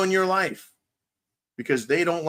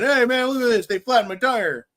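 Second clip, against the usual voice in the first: high, put-on outraged voice; mocking a staged complaint, pitched up; mock fake victim; 7 semitones higher than usual. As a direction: play a fake victim hamming it up. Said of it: "but then saying".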